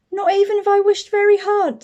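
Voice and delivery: put-on high, pleading little girl voice; wishful child's plea; hopeful child. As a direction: beg like a small hopeful child.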